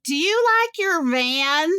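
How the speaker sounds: lightly voiced chatty stranger; imitating a friendly stranger. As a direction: ask the old lady sweetly, slowly. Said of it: "the woman on the bench goes".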